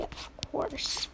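unvoiced whisper; quiet aside. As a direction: whisper the add-on.

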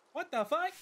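shocked outburst. Real, not acted. Shocked outburst mid line.

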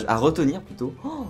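normal talking voice

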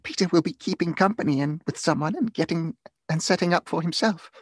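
put-on high-pitched girlish chatter; excited girl. A narrator's reading. Girl teasing her brother about his prospects, excitedly. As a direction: chime in eagerly, pitched well up. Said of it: "cried one of the girls".

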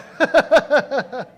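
loud, high burst of laughter; hearty run of ha-ha-has; real laugh. Real, not acted. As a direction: crack up at your own joke. Laughs at own joke.

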